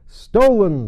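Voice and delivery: loud high shocked cry; exclaiming. Acted. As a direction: blurt it out in alarm. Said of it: "the king exclaimed".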